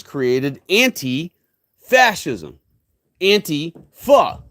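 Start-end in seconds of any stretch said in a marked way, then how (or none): 3.2-4.4 Antifa as "AN-tee-FA", in separate slowed parts; corrected pronunciation. Shown as a demonstration. Speaker corrects listeners' word, Antifa split slowly as anti-fa. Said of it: "They've got you mispronouncing it".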